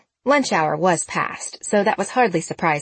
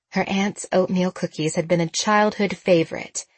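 usual speaking voice

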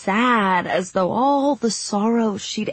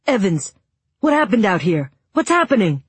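urgent; 2 semitones higher, 5 dB louder than usual. Acted. Teacher demands answers in alarm.

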